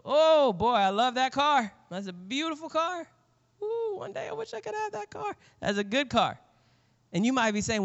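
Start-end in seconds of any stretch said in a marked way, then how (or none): 0.0-6.4 high-pitched mock gushing; put-on admiration. Admiring your rich uncle's dream car, put-on gushing. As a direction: gush in an exaggerated high voice.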